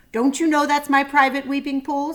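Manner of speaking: booming angry roar; a big beast scolding the intruder; deep angry voice. A narrator's reading. Rhinoceros scolds father for using his pool, angry and loud. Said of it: "said a deep, angry voice".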